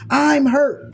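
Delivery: high, loud cry of hurt; hurt outburst. Pretend. Hurt crying out to you, high and loud. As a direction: blurt out the hurt.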